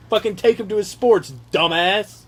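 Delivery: ordinary delivery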